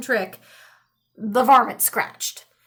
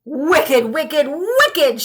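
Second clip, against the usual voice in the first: louder, angry repeated shout; 4 dB louder, much slower than usual. A narrator's reading. Old man shouts at the tricky varmint, loud. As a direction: scold him word by word. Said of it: "shouted the old man".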